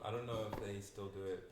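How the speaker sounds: ordinary talk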